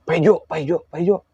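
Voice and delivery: calling out. Retold or imitated. Teller reenacts calling unresponsive Pak Ijo, calling out. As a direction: call a name to rouse someone. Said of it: "apa namanya, dipanggil lah".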